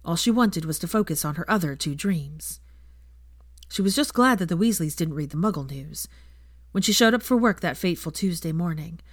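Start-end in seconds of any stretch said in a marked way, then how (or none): none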